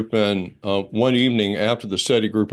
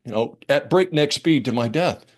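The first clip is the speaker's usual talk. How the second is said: normal talking voice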